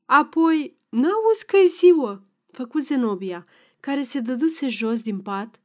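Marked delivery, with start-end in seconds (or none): 0.1-2.2 higher, louder scolding wife; exasperated scold. A narrator's reading. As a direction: snap at him to wake up. Wife scolding sleepy husband.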